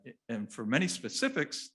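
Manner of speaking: normal talking voice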